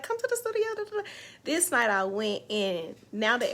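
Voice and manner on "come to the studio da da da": high mock invite, lightly voiced; a playful high imitation of her friend; imitating Missy inviting. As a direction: pitch it up like Missy calling.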